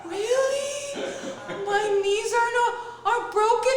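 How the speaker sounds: very high mock-crying squeak; hammed-up sobbing question, loud and high; tearful disbelief, imitated. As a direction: ask it like a weeping client. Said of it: "she got the crocodile tears".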